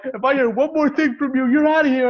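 high, loud warning building to the end; umpire's ejection threat. Retold or imitated. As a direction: imitate an ump losing patience.

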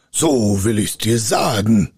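heavy, threatening spirit voice; ominous spirit call; menacing spirit. A narrator's reading. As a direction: announce it like a fearsome giant. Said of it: "rief der Geist".

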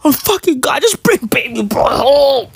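tearful, whining plea; mock crying. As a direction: wail it as if about to cry. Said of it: "I'm gonna cry".

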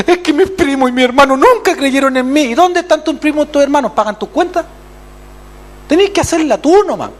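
high, loud scolding coach; mock coach voice. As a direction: bark at the player like a fed-up coach. Voicing a coach scolding player. (voz de entrenador regañando, aguda y fuerte)

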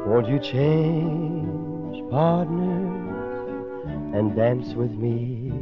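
usual speaking voice

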